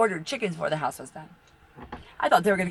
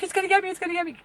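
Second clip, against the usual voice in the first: scared; 12 semitones higher, much faster than usual. Retold or imitated. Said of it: "I wouldn't even go outside".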